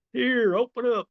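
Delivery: put-on high singsong feeding-a-baby voice; coaxing a baby. George tries to feed captives like a baby, mock coaxing. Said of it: "like a little baby".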